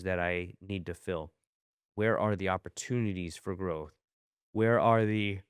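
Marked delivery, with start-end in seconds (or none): 4.5-5.4 words stretched by a yawn. Real, not acted. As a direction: talk through a yawn. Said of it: "keep yawning".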